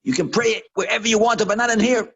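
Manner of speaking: fast, high, very loud shouting; angry yelling. Retold or imitated. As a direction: bellow a furious dismissal. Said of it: "the woman yelled at her".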